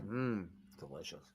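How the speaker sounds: low, hushed Scottish impression; Sean Connery. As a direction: savour it in a soft, deep Scottish voice. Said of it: "Sound like Sean Connery".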